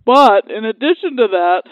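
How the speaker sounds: panting, short of breath. Real, not acted. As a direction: gasp through the words. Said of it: "I'm out of breath".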